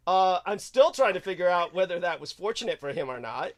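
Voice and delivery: high, loud, exasperated ranting. Acted. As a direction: blow up into a loud, high rant. Sudden loud, high persona rant.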